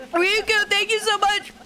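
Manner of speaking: character voice. Acted. Reading character dialogue aloud.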